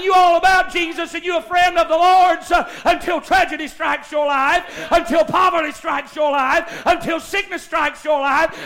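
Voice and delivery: loud, driving revival sermon; full-force preaching. Real, not acted. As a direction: build urgently through repeated phrases, stretching out the last words.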